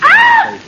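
very high, loud scream of fright; terrified scream. Acted. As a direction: scream out in sudden terror. Sudden fright in the car.

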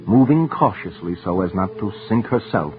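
ordinary delivery, nothing marked

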